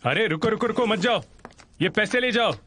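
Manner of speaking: high call after someone leaving. Acted. Man calls after the departing woman to take the money back. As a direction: call her back before she's gone. Calling after her as she leaves.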